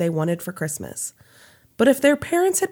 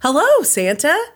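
bright high, louder greeting; cheerful; 7 semitones higher, 5 dB louder than usual. A narrator's reading. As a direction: call out a cheery hello. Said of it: "said cheerfully".

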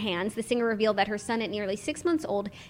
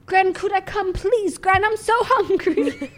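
much higher, slow pleading; whiny begging grandson; 9 semitones higher, 5 dB louder, slower than usual. Pretend. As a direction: wheedle Gran for food. Begging Gran for tea.

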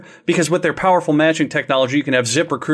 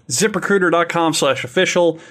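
ordinary delivery

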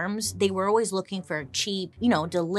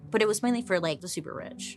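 ordinary talk, nothing marked

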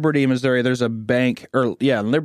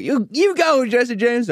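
much higher, gushing fan voice; cheering fan; 11 semitones higher, slower than usual. Retold or imitated. Townsfolk cheering on Jesse James in a high gushing voice. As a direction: cheer him on like a starstruck fan. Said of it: "People are like".

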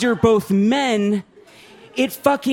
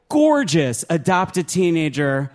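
normal talking voice

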